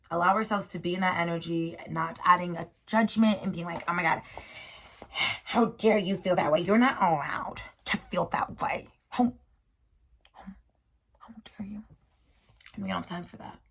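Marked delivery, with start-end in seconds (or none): 3.9-11.8 mock-scolding, whispered outrage; hammed-up inner critic fading to a whisper; judgmental inner voice. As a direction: scold yourself in a hushed, shocked voice. Voicing the judging inner voice.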